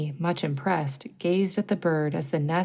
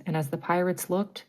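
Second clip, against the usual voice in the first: ordinary delivery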